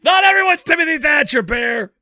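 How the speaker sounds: loud, high playful yell at his wife; laughing shout. Real, not acted. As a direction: shout it at her, half laughing.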